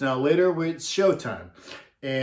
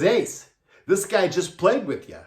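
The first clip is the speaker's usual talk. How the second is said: louder, higher correcting tone; exasperated reminder; 5 semitones higher, 3 dB louder than usual. Retold or imitated. Manager to Ace about the bass player, exasperated. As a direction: imitate a manager setting the star straight.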